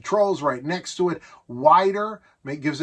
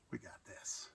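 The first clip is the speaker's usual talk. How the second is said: unvoiced whisper; hushed reveal; 24 dB quieter than usual. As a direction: breathe it out as a secret. Whispered aside before showing TV.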